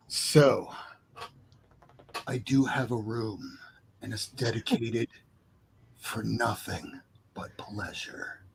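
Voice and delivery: slow, soft seductive whisper. Shown as a demonstration. Showing the guys how to pitch the pleasure room, slow soft whisper. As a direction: breathe it low and slow like a come-on. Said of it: "here's one way to approach it".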